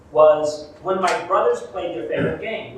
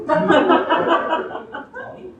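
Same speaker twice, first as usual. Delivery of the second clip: high, loud, laughing drawn-out Tony; mob-style Tony; 10 semitones higher, 4 dB louder than usual. Retold or imitated. Barista asking which Tony, hammed-up wise-guy Tony. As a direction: stretch the name like a wise guy. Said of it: "mobster Tony".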